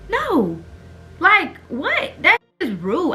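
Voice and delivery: high-pitched indignant protest; indignant disbelief. Retold or imitated. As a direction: push back high and incredulous, offended. Indignant at being disrespected.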